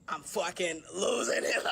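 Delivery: loud, drawn-out mock cry; mock breakdown. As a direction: shout it slowly, hammed up. Retold breakdown confession to father.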